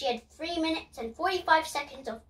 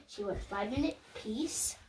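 ordinary delivery

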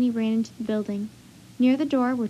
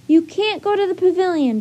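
raised, loud protest; urgent cry; 7 semitones higher, 6 dB louder than usual. A narrator's reading. Jack warns the wagon driver, urgent cry. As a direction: cry out to warn someone off. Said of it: "Jack cried".

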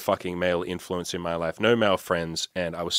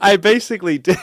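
laughing; 11 semitones higher, 9 dB louder than usual. Real, not acted. Admits the spanking, laughing.

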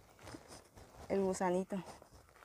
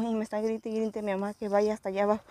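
ordinary delivery

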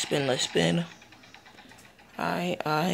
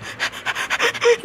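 high giggle. Real, not acted. Laugh mid-sentence.

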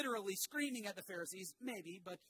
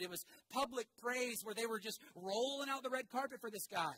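normal talking voice

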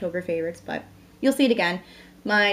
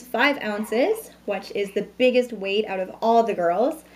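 usual speaking voice

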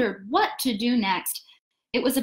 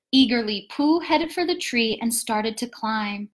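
usual speaking voice

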